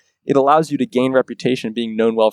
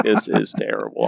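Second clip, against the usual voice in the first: a much lower, softer imitation of a grumpy shop owner; low quiet quote; 5 dB quieter than usual. Shop owner dismissing the survey, in a low quiet mock voice. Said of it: "he was like".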